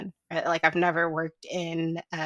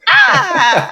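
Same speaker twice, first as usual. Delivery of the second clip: excited squeal; 12 semitones higher, 12 dB louder than usual. Retold or imitated. Squealing at a skin-tone twin.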